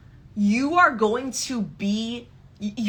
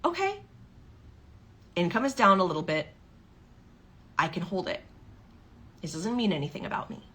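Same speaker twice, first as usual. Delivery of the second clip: softer, steady reassurance to yourself; calm self-talk; 6 dB quieter, faster than usual. Retold or imitated. Coach voicing the client who stays calm, softer and reassuring. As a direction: settle yourself down quietly. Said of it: "Or you go the route of".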